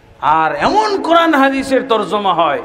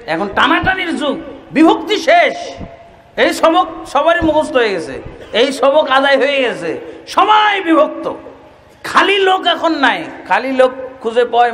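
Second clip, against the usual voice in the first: higher, raised preaching cry; fiery preaching; 6 semitones higher than usual. Acted. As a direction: drive the point home to the crowd. Preacher rising to full force.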